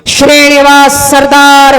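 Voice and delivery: slow, drawn-out sentry's call; announcing call. Acted. Man outside answers who is there, long announcing call. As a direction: call the title out long and level.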